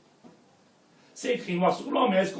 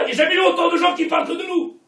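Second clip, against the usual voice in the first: loud, fast, higher outburst; fiery sermon shout; 9 semitones higher, 7 dB louder, faster than usual. Real, not acted. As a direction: hammer it home at full force. Preacher rebuking the congregation.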